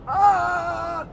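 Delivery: surprised shout. Real, not acted. Sudden reaction while reading chat.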